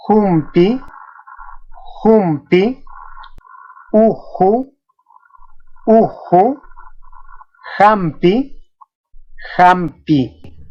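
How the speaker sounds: "Jumpi, uju, jampi" with j as a light h; teacher modelling "Jumpi, uju, jampi", no throat scrape in the j; soft aspirated J. Shown as a demonstration. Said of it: "suena más suave que la J española".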